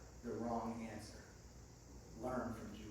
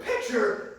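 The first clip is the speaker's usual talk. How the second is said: loud, high-pitched mock yell; eager shout; 6 semitones higher, 8 dB louder than usual. Imitating a friend racing into the photo, eager shout. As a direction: shout it like you can't wait.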